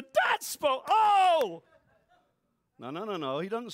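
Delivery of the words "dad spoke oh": loud, high amazed oh; mock awe. Children amazed their dad spoke, mock awe. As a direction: pass on the news in awe. Sister told, gasps.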